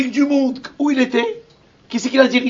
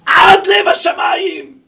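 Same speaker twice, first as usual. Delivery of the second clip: soaring shout; 7 semitones higher, 9 dB louder, slower than usual. Acted. Describes burning mountain.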